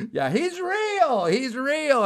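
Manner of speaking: high, excited call to a crowd; overjoyed announcement. Retold or imitated. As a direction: call out the amazing news, imitating her joy. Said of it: "This was the happiest woman I've ever talked to".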